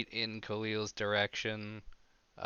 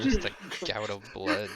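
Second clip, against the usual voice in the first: usual speaking voice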